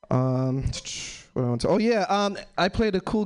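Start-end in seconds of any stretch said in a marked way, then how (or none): none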